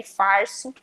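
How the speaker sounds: farso com R bem puxado; caipira R. Shown as a demonstration. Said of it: "Puxa muito R". (farso com R retroflexo)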